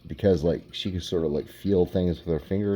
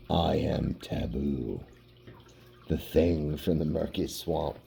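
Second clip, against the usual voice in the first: monster voice; 4 semitones lower, 6 dB quieter than usual. Pretend. Reading monster's caption aloud.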